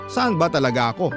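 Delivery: ordinary talk, nothing marked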